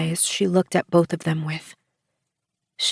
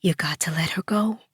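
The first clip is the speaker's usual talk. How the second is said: softer, whispered coaxing; gentle whisper; 5 dB quieter than usual. A narrator's reading. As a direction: coax her in a hush. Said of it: "she gently coaxed".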